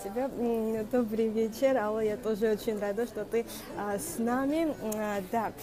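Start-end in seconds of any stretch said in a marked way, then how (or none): none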